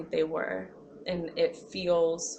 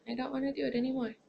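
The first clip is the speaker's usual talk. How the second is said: quick, higher, fed-up outburst; rushed frustration; 5 semitones higher, 4 dB quieter, faster than usual. Retold or imitated. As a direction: blurt it out fast and frustrated. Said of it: "I can just become depressed".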